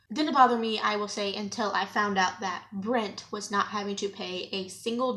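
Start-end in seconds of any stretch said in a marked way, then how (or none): 0.1-0.8 loud, emphatic repeat. Real, not acted. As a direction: say it out loud with force. Repeats line, contrasting delivery.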